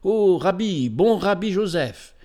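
high, lilting call to the rabbi; woman crying out. A narrator's reading. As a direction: hail him eagerly as you run up. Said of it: "cria une femme en courant à sa rencontre".

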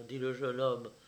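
ordinary delivery

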